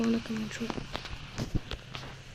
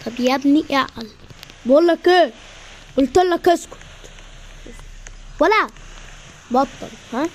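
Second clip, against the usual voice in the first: angry shout; 2 semitones higher, 7 dB louder than usual. Acted. Brothers arguing on the couch, angry shout. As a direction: blow up, shouting him down. Quarrel boils over.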